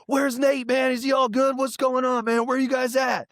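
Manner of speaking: high, urgent string of questions; imitating a worried caller. Rapper calls to check on his friend, high worried imitation. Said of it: "And he's like".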